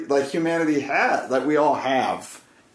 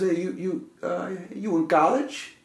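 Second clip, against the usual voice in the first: put-on Greek accent, pitched up; 5 semitones higher than usual. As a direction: question haltingly in an imitated Greek accent. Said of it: "he had a Greek accent".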